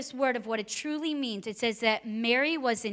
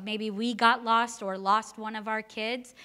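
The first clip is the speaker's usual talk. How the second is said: ordinary delivery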